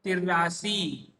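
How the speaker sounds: तिरासी drawn out slowly, syllable by syllable; teacher modelling तिरासी slowly; slow pronunciation demo. Shown as a demonstration. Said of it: "उच्चारण किया जाता है?".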